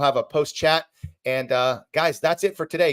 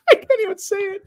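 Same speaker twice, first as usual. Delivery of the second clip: much higher, squeaky laughing take on I can't even say it. Real, not acted. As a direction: crack up while trying to say it.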